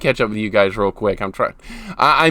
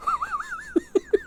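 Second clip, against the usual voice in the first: high squeaky laugh; 11 dB quieter than usual. Real, not acted. After dying.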